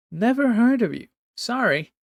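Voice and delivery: high, casual, dismissive; mocking young man. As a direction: toss it off carelessly.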